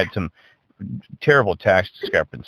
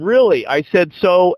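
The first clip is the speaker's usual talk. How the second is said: incredulous; 8 semitones higher, 4 dB louder, slower than usual. Retold or imitated. Retold disbelief on the phone.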